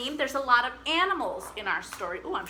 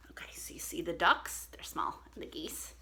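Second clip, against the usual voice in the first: 1 whispered aside; 8 semitones lower, 8 dB quieter than usual. Real, not acted. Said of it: "whispered aside".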